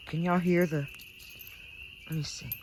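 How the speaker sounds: normal talking voice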